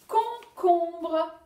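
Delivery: Concombre called out loud; loud teaching word. Shown as a demonstration. Teacher calling the word to pupils, loud. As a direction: call out Concombre. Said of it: "vous allez dire si on entend".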